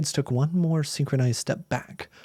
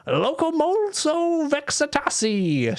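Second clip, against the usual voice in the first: high, slow shouted incantation; spell shout; 13 semitones higher, slower than usual. Acted. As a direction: cast the spell in a ringing high cry. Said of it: "shouted Missus Carline".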